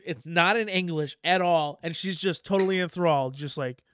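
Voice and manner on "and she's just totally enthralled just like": usual speaking voice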